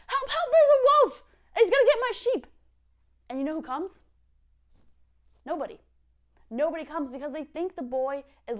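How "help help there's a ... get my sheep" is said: high, loud desperate cry; shepherd boy finally facing a real wolf, high and loud. Retold or imitated. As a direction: yell for real this time like a scared boy. Boy yells one last time.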